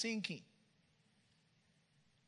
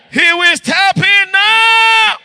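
louder and higher, slowed down; loud slow shout; 10 semitones higher, 18 dB louder, slower than usual. Acted. Pastor promising the church, slow loud shout. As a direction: proclaim it at full force. Repeated, rising preaching.

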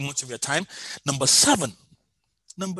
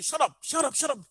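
crowd hushing someone; 10 semitones higher, 4 dB quieter than usual. Retold or imitated. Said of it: "People were telling me".

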